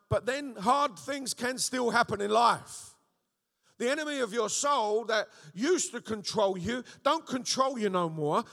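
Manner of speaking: loud, rising preacher's cry; full-force preaching. Acted. Preacher rallying the congregation, loud and fervent. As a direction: preach it hard and build to a roar.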